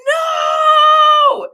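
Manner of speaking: very high shrieked, drawn-out "no"; dismayed wail. Real, not acted. Streamer lost the comment she wanted to pin, dismayed wail. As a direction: wail in squealing, stretched-out protest. Upset that a comment got deleted.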